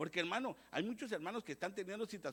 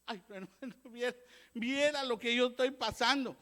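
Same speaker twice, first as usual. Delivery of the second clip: mock complaining believer; 4 semitones higher, 6 dB louder than usual.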